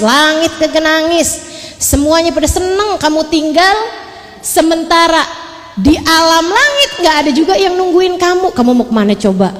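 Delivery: stern rebuke. Acted. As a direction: rail at the dead tyrant from on high. Memerankan Allah menegur Fir'aun. (menegur Fir'aun dengan keras dan tinggi)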